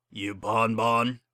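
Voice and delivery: slow, heavy gruff question; slow tough-guy drawl; menacing thug. A narrator's reading. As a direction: loom over her as a hired heavy. Thug stallion questions her.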